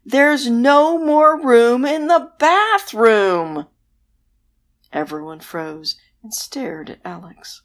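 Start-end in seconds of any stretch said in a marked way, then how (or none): 0.0-3.7 loud, high, slow shout; fed-up boy shouting at monsters; exasperated yell. A narrator's reading. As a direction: bellow it out in frustration. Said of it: "Alex yelled".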